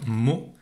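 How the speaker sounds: mo said alone, drawn out as a syllable. Shown as a demonstration. Teacher to child, slowly isolating the syllable mo. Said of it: "Je l'entends dans la première syllabe".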